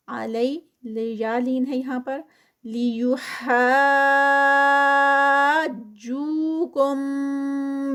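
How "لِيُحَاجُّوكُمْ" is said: slow drawn-out tajweed recitation, vowels held; Quran recitation. Shown as a demonstration. Tajweed teacher reciting a verse for students, slow melodic recitation. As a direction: chant each word with its lengthening. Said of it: "یہ six counts پر".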